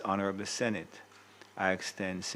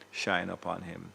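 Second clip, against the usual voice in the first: usual speaking voice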